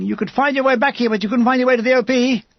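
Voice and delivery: rising into a pressed, accusing reproach; shouting, threatening. A narrator's reading. Major berates soldier who came back wounded, threatening shout. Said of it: "He's shouting at me and threatening me".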